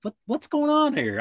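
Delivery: high-pitched, baffled questioning; confused. Retold or imitated. Deputy back at the station asking the dispatcher, confused. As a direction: act out bewilderment, pitch climbing sharply to the end. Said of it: "I'm, I'm confused".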